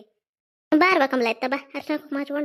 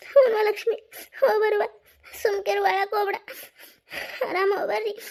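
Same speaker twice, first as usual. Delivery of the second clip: high, sobbing goodbye; crying farewell; 6 semitones higher than usual. Acted. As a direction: send them off while crying. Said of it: "ಯಾಕ್ ಅಳ್ತೀರಿ ಕಮಲ". (ಅಳುತ್ತಾ ಬೀಳ್ಕೊಡುಗೆ)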